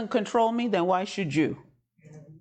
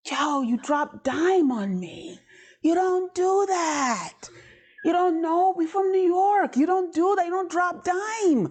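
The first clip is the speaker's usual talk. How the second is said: mock outrage; 11 semitones higher, slower than usual. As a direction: scold him like a betrayed New Yorker. Scolding Jesus, New York style.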